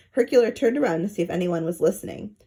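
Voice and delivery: ordinary delivery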